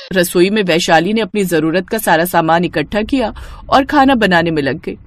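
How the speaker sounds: ordinary delivery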